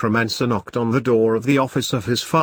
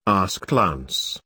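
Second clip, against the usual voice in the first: plain reading voice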